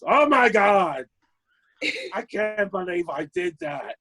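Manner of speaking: high, frantic shout of sorry, slow and stretched; panicked drunk apology. Retold or imitated. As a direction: squeal it like a drunk who just wrecked everything. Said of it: "In my drunk, sober voice".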